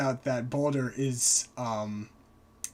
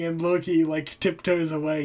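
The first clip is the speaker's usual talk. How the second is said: ordinary talk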